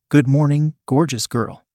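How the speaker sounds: usual speaking voice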